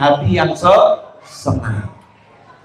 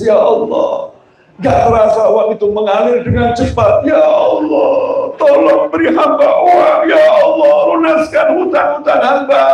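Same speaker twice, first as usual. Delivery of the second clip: complaining prayer; 2 semitones higher than usual. Shown as a demonstration. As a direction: whine to God, rising into a cry. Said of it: "yang barusan nggak ngeluh".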